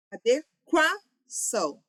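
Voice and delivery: misplace the stress in "adequação": a-de-QUA-ção, in slow pieces. Shown as a demonstration. Teacher mimics the board's wrong stress, "adequação" with QUA punched. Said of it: "como se esta fosse a sílaba tônica".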